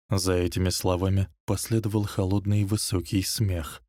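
usual speaking voice

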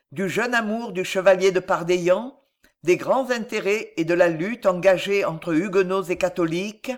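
ordinary delivery

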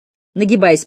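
usual speaking voice

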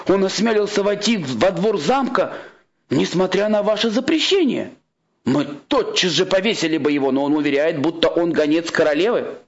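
high-pitched guard's report; guard announcing an outrage to his king; indignant guard. Acted.

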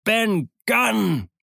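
the name roared out very high; bellowed name. Acted. Silver roars out Ben Gunn's name to the crew. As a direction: bellow the name in sudden realisation. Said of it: "brüllte Silver".

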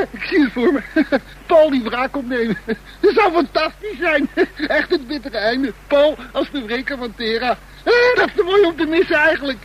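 high, laughing through the words; laughing gleefully. Acted. Bor picturing Paul's revenge, giggling high. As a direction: giggle with delighted malice. Gloating over imagined revenge.

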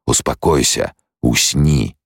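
ordinary delivery, nothing marked

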